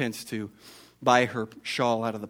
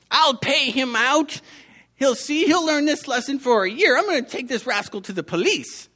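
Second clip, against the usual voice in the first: put-on angry old woman; 11 semitones higher, 4 dB louder than usual. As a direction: rage at the little rascal. Old woman scolds the thief.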